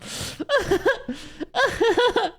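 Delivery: long, high-pitched laughing fit; laughing hard. Real, not acted. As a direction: dissolve into high cackles. Laughs after complaining about watch list.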